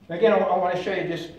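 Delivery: ordinary talk, nothing marked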